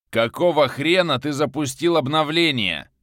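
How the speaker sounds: high, loud furious yell; exploding shout. Acted. Viktor explodes at the man who ran the update, yelling. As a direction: blow up at him. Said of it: "Эх, киллер взорвался".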